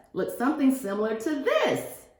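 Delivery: plain reading voice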